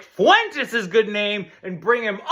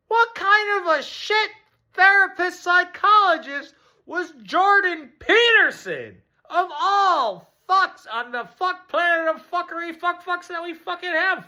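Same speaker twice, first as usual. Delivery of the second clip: incredulous rant; 10 semitones higher than usual. Real, not acted. Comedian ranting about Peterson, high outraged voice. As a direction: rail at him in disbelief.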